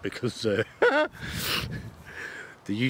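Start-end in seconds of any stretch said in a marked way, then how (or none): 0.7-1.9 squeaky laugh. Real, not acted. Laughs mid instruction.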